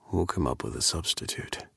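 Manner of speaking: low, soft murmur; hushed seductive murmur. A narrator's reading. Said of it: "he murmured".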